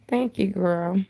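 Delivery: normal talking voice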